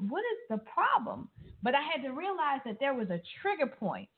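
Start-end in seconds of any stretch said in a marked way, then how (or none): 0.1-1.3 much higher pitch, mock bewilderment; imitated baffled husband. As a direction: play the husband who doesn't get it. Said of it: "he was just like".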